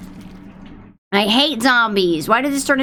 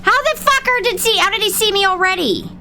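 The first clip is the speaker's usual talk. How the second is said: high, incredulous complaint; outraged disbelief; 9 semitones higher than usual. Real, not acted. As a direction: squeal in disbelief. Outraged at being spotted.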